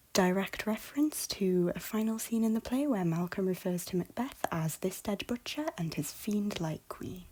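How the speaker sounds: ordinary talk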